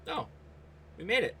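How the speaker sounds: normal talking voice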